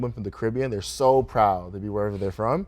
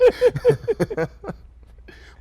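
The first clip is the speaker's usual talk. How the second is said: loud, high burst of laughter; long high laugh, dying away; hearty laugh; 9 dB louder than usual. Real, not acted. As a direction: crack up at your own joke. Laughs at own joke.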